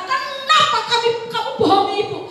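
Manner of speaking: high, loud, angry scolding of a son. Retold or imitated. Mother scolds her son for lying while spanking him, high and loud.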